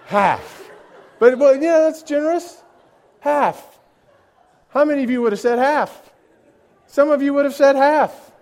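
high, loud mock disbelief; incredulous. Real, not acted. Preacher teasing the congregation over their answer, loud and incredulous. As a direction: exclaim it as if you can't believe it.

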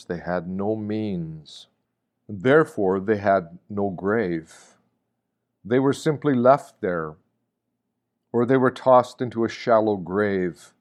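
ordinary delivery

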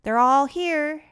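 loud, high, drawn-out call; yelled. A narrator's reading. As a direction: yell it out slowly, pitch climbing. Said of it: "he yelled".

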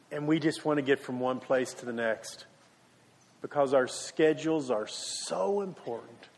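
soft, low prayer with long pauses; hushed prayer, fading to a low amen; closing prayer. Real, not acted.

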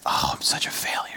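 whispered, deflated; mock self-pitying whisper after missing the job. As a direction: whisper it to yourself, defeated. Self-pity after losing job.

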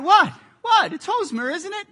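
high, loud startled what, then unsure; baffled protest. Retold or imitated. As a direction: defend yourself, caught off guard. Confused fan after crowd hush.

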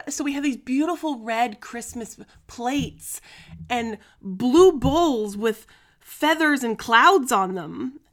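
ordinary talk

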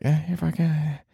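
mock-whine the retreat home; lightly voiced lazy inner voice; talking himself out of it. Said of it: "I just, like".

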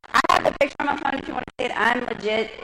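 excited rush. Real, not acted. Bragging to the crowd about the photo, excited and fast. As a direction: blurt it out, thrilled.

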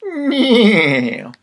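high, descending whinny; long high horse cry; horse neighing. Acted. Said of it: "And the horse shouted out".

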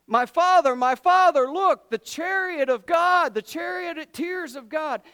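high, loud acted cry of alarm; screaming in awe. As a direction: cry out to your father at an astonishing sight. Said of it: "Elisha starts screaming".

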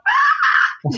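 very high retold squeal; re-enacted shriek of overwhelmed excitement; excited scream. Competition winner overwhelmed on the call, screaming.

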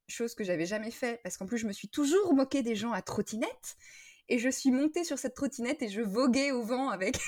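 normal talking voice

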